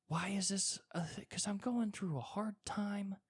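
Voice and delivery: high, breathy whisper of despair. Retold or imitated. To himself over the cracked toilet, a high despairing whisper. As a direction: whisper it like you're at the end of your rope.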